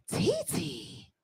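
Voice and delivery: a little niece teasing softly; small child voice. Retold or imitated. Niece quoted.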